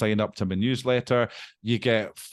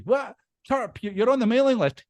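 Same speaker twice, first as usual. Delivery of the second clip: flustered caller; 11 semitones higher than usual. Retold or imitated. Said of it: "phoning people up going".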